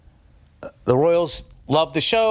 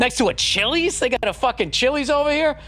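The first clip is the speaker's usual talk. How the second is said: higher, half-laughing disbelief at a Chili's; incredulous; 8 semitones higher than usual. Retold or imitated. A comedian amazed to find a Chili's abroad, higher incredulous. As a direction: pitch it up like you can't believe it.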